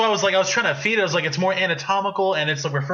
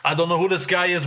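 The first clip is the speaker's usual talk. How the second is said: usual speaking voice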